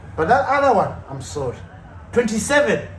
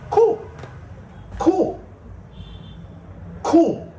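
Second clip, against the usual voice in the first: mocking cool; 10 semitones higher, much slower than usual. Mocking Rashford's attitude.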